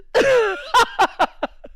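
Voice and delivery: high-pitched burst of laughter. Real, not acted. Laughing at the story.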